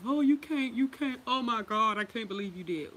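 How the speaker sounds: high, loud fake fuss, oh my God; hammed-up can't-believe-it fuss; mock dramatic protest.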